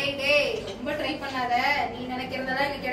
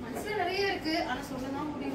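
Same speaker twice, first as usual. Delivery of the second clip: sad confession; 9 dB quieter than usual. Acted. Confessing feelings in skit.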